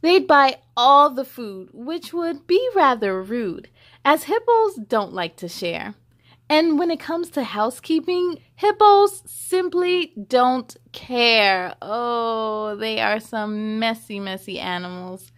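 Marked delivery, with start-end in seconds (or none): 0.0-8.4 put-on lively read-aloud to children; story reading. A narrator's reading. Reading the hippo book to children, lively storybook voice. 8.5-11.7 slow, drawn-out storybook punchline; slow down to land the joke. A narrator's reading. Reading the book's punchline to kids, slowed down.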